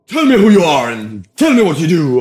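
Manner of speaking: high, loud mock arena delivery; hammed-up commanding stage voice; imitating big-stage speaker. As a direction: boom it out like a motivational showman. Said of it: "Han pratar ju så".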